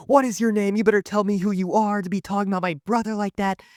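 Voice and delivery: high-pitched, irate demand; mock screaming. Sister demanding the name of brother's mocker, mock screaming. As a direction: imitate an outraged sister demanding answers. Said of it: "just starts screaming".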